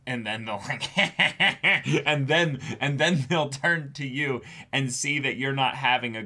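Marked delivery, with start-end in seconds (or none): 0.9-2.0 high, loud staccato cackle; mock maniacal laughter; maniacal kid laugh. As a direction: cackle like a kid squashing bugs. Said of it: "They'll laugh maniacally squashing a bug".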